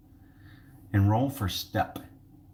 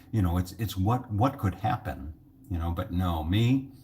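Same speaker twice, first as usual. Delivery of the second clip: ordinary talk, nothing marked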